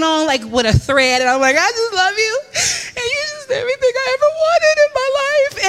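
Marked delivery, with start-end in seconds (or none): 1.6-5.7 high-pitched, hammed-up tearful wail; hysterical crying. Devoted fan to Cinderella, mock crying hysterically. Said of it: "crying hysterically".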